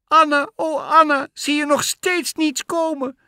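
put-on very high, wailing girl's call; tearful cry. A narrator's reading. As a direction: wail up to your sister, near tears. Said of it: "riep het meisje nu huilend".

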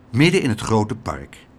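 usual speaking voice

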